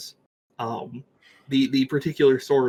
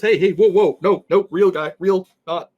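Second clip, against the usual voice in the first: high, loud, stammered plea; frantic "don't hit me" yelp; panicked protest; 7 semitones higher, 7 dB louder than usual. Acted. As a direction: blurt it while ducking a blow. Peter ducks a sword, pleading.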